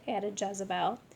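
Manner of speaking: ordinary delivery, nothing marked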